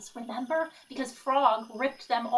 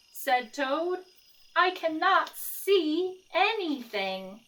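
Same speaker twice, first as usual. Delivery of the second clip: lively picture-book read-aloud; reading to children. A narrator's reading. Teacher reading Frog and Toad to the class, lively storytelling. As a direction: read it like story time for kids.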